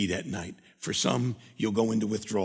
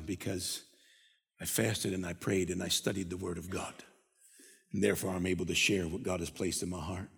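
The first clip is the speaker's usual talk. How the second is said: lower, softer acted answer; quiet testimony; 3 semitones lower, 6 dB quieter than usual. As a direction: answer them softly, pitched down. Voices believer answering the people.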